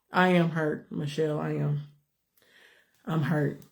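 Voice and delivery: hurt and close to tears; soft, worn-out, near crying. Real, not acted. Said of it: "I'm tired of crying".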